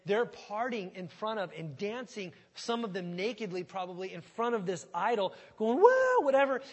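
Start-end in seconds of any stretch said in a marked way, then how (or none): 5.8-6.6 loud, high wild whoop; party whoop. Acted. Preacher gets carried away acting the party, whooping loud. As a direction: let it rip like a party crowd. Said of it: "I got ahead of myself there".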